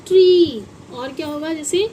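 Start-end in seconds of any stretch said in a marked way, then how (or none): 0.1-0.6 emphatic word. Shown as a demonstration. Teacher calling out tree for children. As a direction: sing out tree for the class. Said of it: "क्या बनाइए".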